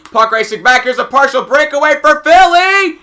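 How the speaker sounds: loud, rising play-by-play shout; excited call. Real, not acted. Announcer calling a breakaway, excited rising shout. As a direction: build to a high yell on the breakaway.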